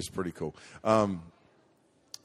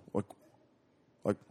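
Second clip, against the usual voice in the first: ordinary talk, nothing marked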